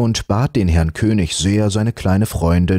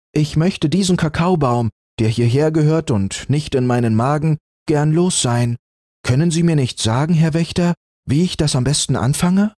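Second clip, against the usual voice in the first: put-on higher, plucky child's voice; brave little girl; 8 semitones higher than usual. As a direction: ask boldly like a small girl. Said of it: "sagte Lieselotte beherzt".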